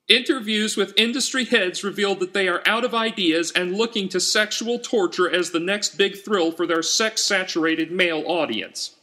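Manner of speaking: high, shrill, ranting voice for her notes; high-pitched indignant screed; furious strident activist. A narrator's reading. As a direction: spit it out like an outraged campaigner. Danvers's outraged notes voiced again.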